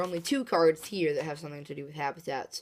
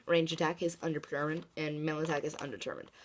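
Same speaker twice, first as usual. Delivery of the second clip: ordinary talk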